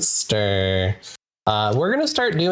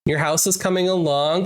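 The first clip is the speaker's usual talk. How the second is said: ordinary talk, nothing marked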